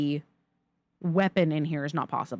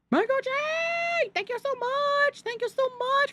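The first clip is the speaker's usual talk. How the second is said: excited squeal. Real, not acted. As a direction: squeal your thanks, stretching the words. Thanking a viewer for bits.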